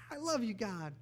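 high-pitched, breakaway cry of affection; childlike adoration. Acted. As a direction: call it out like a child running to a parent. Acts out running to God.

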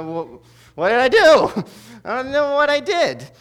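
high, loud confused laughing protest; baffled, laughing it off; bewildered. Retold or imitated. Bewildered boy reacting to praise.

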